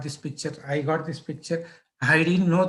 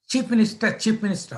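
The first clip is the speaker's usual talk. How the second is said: higher, irritated echo; mad chief sub; 5 semitones higher, faster than usual. Retold or imitated. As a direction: throw the title back as an annoyed boss. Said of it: "he was mad that day".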